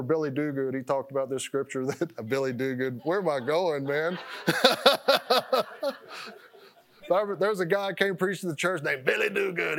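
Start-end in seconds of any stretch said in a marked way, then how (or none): 4.2-6.3 high bursting laugh at himself; burst of laughter. Real, not acted. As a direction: crack up at losing the thread. Laughs at losing his thread.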